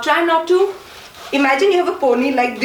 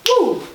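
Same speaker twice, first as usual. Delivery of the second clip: sudden high-pitched whoop; short high "woo"; 11 semitones higher than usual. Shown as a demonstration. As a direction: punctuate the move with a woo. Vocal cue for the move.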